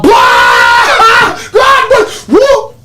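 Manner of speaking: high-pitched whooping mock guffaw; loud laugh imitation. Kid busting out at a friend's joke, hammed-up loud laugh. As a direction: burst out in exaggerated, squealing laughter. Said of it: "guess who's the loudest laugher in the house".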